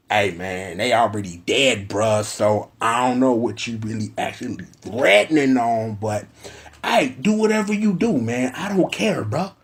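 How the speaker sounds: a lightly voiced lazy stan; unbothered Me Tan. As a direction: shrug it off, low and bored. Me Tan answers threat lazily.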